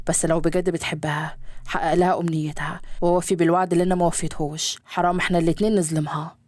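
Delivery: low fatherly voice, lightly teasing. Acted. Father advising his son about his wife, low male voice. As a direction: keep it low and easy like the father.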